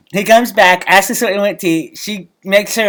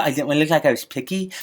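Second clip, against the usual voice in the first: normal talking voice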